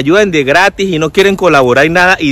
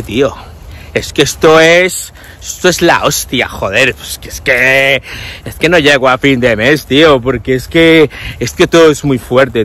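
exaggerated Spain accent; 4 semitones lower than usual. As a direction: parody a newcomer forcing a Spain accent. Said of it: "pues, normal que hable así". (acento de España exagerado y arrastrado)

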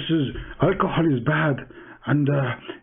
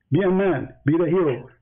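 ordinary talk, nothing marked

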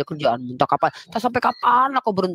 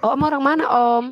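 flirty coy question; 10 semitones higher than usual. Pretend.

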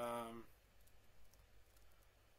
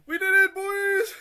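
triumphant shout; 18 dB louder than usual. Real, not acted. To the boys after winning, a high victory yell. Celebrating finishing the course.

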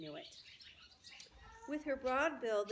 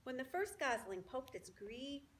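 ordinary delivery, nothing marked

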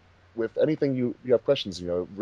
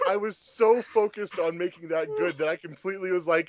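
laughing; 9 semitones higher, 3 dB quieter than usual. Real, not acted. Laughing at own mistake.

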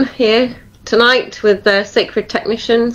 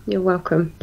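much softer, trailing repeat; a quiet afterthought repeat of the thanks; soft echo; 8 dB quieter than usual. Real, not acted. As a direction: say "You're welcome" again much softer, almost to yourself.

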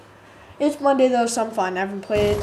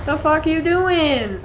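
higher and louder, slowed exasperated question; exasperated yell; 6 semitones higher, 4 dB louder, slower than usual. Real, not acted. Gamer yelling at a clueless teammate, exasperated. Teammate walks past enemy.

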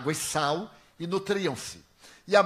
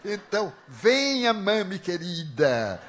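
mock sweet call; 4 semitones higher, 7 dB louder, slower than usual. Teasing the reborn mother spirit.

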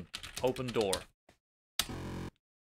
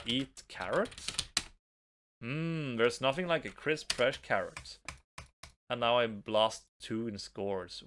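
ordinary talk

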